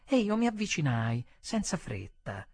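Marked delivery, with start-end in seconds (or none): none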